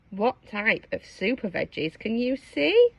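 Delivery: plain reading voice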